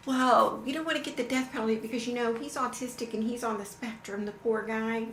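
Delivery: put-on poor-him voice; mock sympathy. Mocks the defense's excuse.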